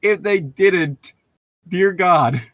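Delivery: hammed-up mock horror; mock dismay. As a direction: ham up the horror. Continuing high mock voice.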